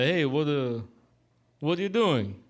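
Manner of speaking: lightly imitated Russian-Italian accent; mock Russian accent, hesitant joke-telling. Barber telling the line joke, mock Russian accent. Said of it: "he's got this Russian accent".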